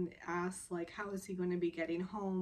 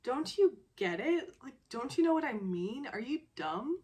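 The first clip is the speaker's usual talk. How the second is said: exasperated, higher and a bit louder; acted-out frustration; 5 semitones higher, 4 dB louder than usual. Frustrated man challenging her, exasperated. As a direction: retell his fed-up, pressing questions. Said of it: "He's just frustrated at this moment".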